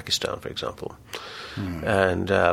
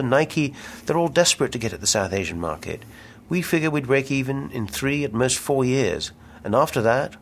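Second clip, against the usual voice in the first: a touch furious; 6 semitones higher than usual. A narrator's reading. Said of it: "Chuck said almost furiously".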